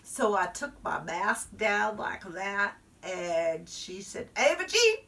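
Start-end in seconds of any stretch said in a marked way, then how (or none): 4.4-5.0 sharp scolding call. Acted. Teacher scolds nose scratching.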